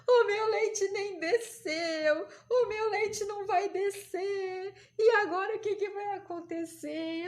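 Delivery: high, slow wailing, acted out; mock crying. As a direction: wail like a sobbing new mother, hammed up. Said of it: "por que que você tá chorando".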